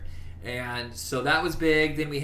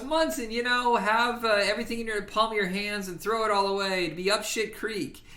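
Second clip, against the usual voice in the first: higher put-on voice, lightly preachy; mock character speech; 8 semitones higher than usual. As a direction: quote him up in a higher voice. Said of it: "And he goes".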